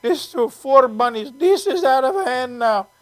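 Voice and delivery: slow, mock-overwhelmed Indian accent; drawn-out imitation of an Indian accent. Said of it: "I love doing that accent".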